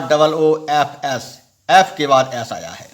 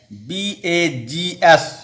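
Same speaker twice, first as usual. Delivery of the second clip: usual speaking voice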